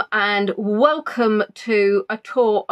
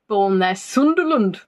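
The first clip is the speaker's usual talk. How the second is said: put-on Sunderland accent. Host reads a viewer's town out in a put-on Sunderland accent. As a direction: try the place name the Mackem way, badly. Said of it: "That's a terrible, terrible accent".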